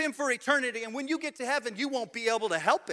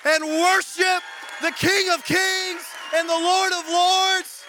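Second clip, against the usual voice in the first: loud, high rallying call to stand and worship; preaching at full force; 7 semitones higher, 5 dB louder than usual. Acted. Pastor urging the church to stand, loud rallying cry. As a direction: whip the room up into praise.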